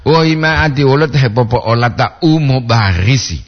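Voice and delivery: normal talking voice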